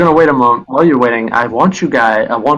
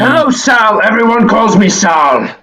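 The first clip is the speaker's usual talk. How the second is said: higher-pitched Russian creature voice; heavy Russian accent; 8 semitones higher than usual. Acted. Creature challenging a visitor, heavy Russian accent. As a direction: play a Russian tower guardian. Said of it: "So a deep Russian voice".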